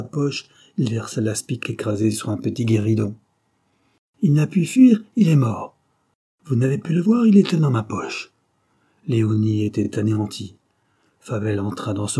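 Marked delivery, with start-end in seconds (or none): 4.2-8.3 high, loud damning reveal; emphatic confrontation in a raised voice; triumphant accusation. A narrator's reading. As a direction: drive the proof home forcefully. Accuser springs his proof.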